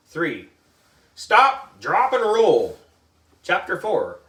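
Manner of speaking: plain reading voice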